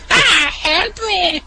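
high slow laughing repeat; laughing grunt impression. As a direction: try the grunt again through laughter. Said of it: "grunt impression".

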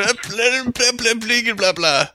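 high repetitive mock stammer; mocking stuck speech. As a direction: parody a stuck record. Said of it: "You're really stuck in that word".

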